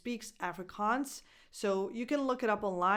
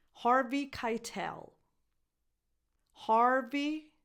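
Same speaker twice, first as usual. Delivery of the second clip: ordinary delivery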